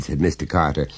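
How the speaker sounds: usual speaking voice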